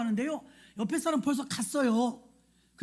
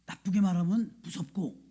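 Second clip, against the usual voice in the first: ordinary talk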